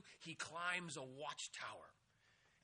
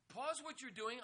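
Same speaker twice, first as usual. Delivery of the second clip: urgent higher repeat; 7 semitones higher than usual. Shown as a demonstration. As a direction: lift PAUSE high and make it rise. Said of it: "Pause whatever you are doing".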